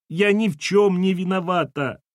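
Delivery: high-pitched wounded denial; tearful protest. Acted. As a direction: protest innocence through welling tears. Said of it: "налились слезами".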